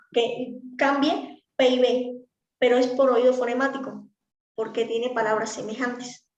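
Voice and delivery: ordinary talk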